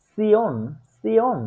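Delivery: সিওন said as "zi-on", not "shon"; সিওন, German zi-on sound; German tion ending. Shown as a demonstration. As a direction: say সিওন with a clear z and open o. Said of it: "ডয়েচে এটা উচ্চারিত হয়".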